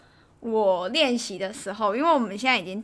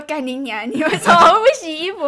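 mock angry yell; 4 semitones higher, 7 dB louder than usual. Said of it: "我就说".

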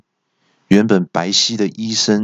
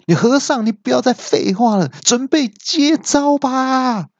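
villain's yell. A narrator's reading. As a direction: cry out a high battle challenge. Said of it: "这个时候恶怪就大叫说". (不耐烦地高声大叫)

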